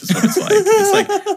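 laughing through the words, breaking up high. Real, not acted. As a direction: laugh out the punchline. Laughing at own comparison.

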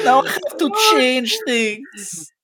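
slow, high, wailing villain lament. Acted. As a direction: whine it like a defeated villain. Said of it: "That's the B B E G voice".

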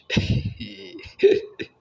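high, loud burst of giggling; amused laugh. Real, not acted. Amused by the song's line.

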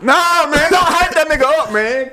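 loud, high-pitched comic whine; mock protest. Host begging friend not to hype him up, mock protest. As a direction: plead way up high in playful exasperation.

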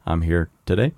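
low, halting presidential address; Barack Obama. Acted. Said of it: "here's my really bad Obama".

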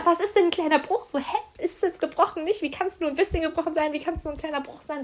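high, exasperated string of questions; hammed-up frustrated disbelief; incredulous. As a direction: pile up baffled questions. Baffled by a "little" fracture.

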